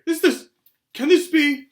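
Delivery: high, loud, incredulous barman; astonished disbelief. A narrator's reading. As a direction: cry out as if unable to believe it. Barman recognises Harry, stunned.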